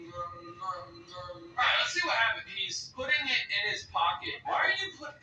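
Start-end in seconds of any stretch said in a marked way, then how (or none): 0.1-1.4 soft, much quieter nom-nom munching; hushed playful chewing sounds; mock eating noises. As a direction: coo the noms like a contented eater. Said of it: "closing my eyes and just nom".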